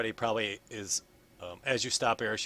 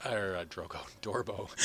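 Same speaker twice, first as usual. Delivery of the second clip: ordinary talk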